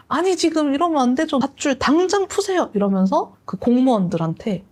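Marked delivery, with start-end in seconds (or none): 0.1-2.7 high, indignant demand; worked-up protest. Retold or imitated. Don Quixote scolding the officials over the convicts, high and indignant. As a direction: rail at the guards. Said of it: "돈키호테 막 흥분하면서".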